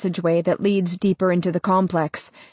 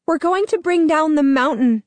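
urgent woman's voice; 10 semitones higher, 4 dB louder than usual. Acted. Ella speaks into his mind.

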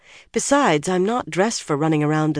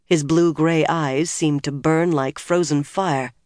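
plain reading voice

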